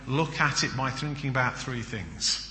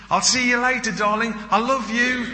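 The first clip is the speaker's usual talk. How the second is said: high, louder, gushing farewell; loving wife; 10 semitones higher, 6 dB louder than usual. Acted. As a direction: gush the goodbye like the wife.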